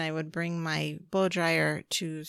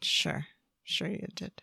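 doubtful; 2 semitones lower, 8 dB quieter than usual. Real, not acted.

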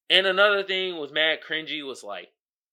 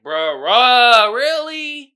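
incredulous; 8 semitones higher, 12 dB louder, much slower than usual. Real, not acted. Exasperated disbelief at the movie.

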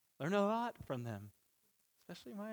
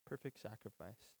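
soft repeat; 5 semitones lower, 13 dB quieter than usual. Shown as a demonstration. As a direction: echo it back much softer, almost under the breath. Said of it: "He is the perfect sacrifice".

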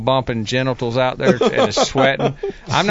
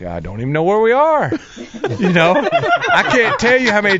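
laughing through the line; amused confession, chuckling; 9 semitones higher, 4 dB louder than usual. Retold or imitated. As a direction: angler telling his marshal, laughing. Laughing retelling.

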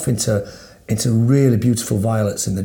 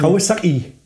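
higher-pitched mock Geordie Newcastle accent; 5 semitones higher than usual. Shown as a demonstration. Showing a friend the trick for accents, Newcastle accent. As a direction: say Kawasaki as a jokey Newcastle accent exercise. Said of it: "say Kawasaki if you want to do a Newcastle accent".

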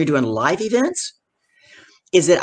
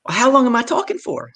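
higher-pitched appeal to Marge; agitated retold appeal; 6 semitones higher than usual. Speaker asks Marge how long she talks, agitated. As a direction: reenact an urgent question, pitched up.

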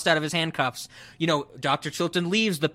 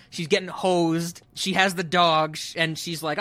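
ordinary delivery

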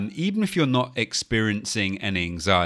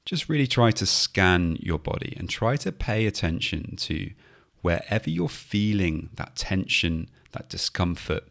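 guided meditation. Acted. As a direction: gently talk them through the breath. Leads a mindfulness body scan.

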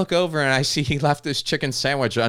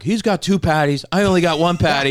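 high, louder mock whine; whiny complaint; 8 semitones higher, 7 dB louder than usual. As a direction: complain like a cheated customer. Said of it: "He's like".

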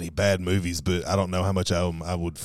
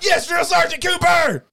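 recruit shouting; 6 dB louder than usual. Acted. Recruit answering drill sergeant.

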